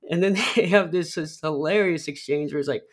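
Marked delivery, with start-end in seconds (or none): none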